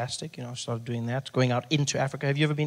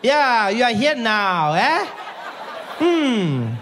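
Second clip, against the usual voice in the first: high, loud, slow taunting; gloating nurse; 8 semitones higher, 7 dB louder, much slower than usual. Retold or imitated. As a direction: savour his bad luck.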